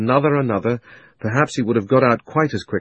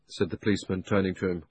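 ordinary delivery, nothing marked

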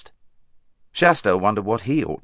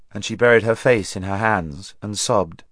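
ordinary delivery

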